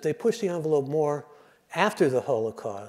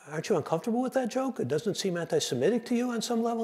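mock concern in a much higher voice; concerned questioning; 9 semitones higher, 4 dB quieter than usual. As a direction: imitate a worried objector, pitched high and quick. Voices Gentiles' worried question about joke.

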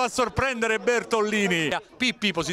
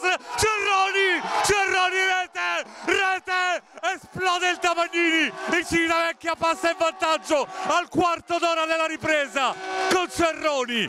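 breathless italian goal commentary; 5 semitones higher than usual. Real, not acted. Goal scored, crowd explodes.

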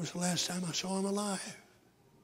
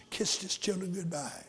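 ordinary talk

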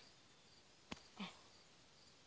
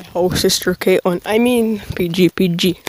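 shouting about his sister; loud rant; 9 dB louder than usual. Real, not acted. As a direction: yell it out then backpedal.

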